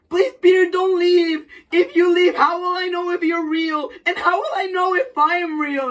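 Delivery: high, desperate begging; pleading Harry. Retold or imitated. Harry pleading with Peter not to leave, high and desperate. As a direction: plead like a man losing his grip.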